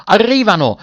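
loud, high-pitched cry; very high shout. A narrator's reading. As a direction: shout the news at the top of the voice. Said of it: "gettò un grido altissimo".

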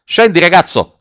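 high, loud call; shouted order. A narrator's reading. Officer ordering the boy down, shouting. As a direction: shout up to bring someone down. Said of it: "gridò l'ufficiale".

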